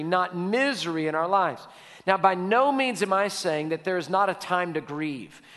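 ordinary talk, nothing marked

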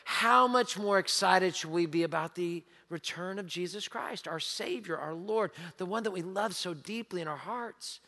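soft reverent hush about the Lord; tender. Real, not acted. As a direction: draw them close with awe. Tender turn toward Christ.

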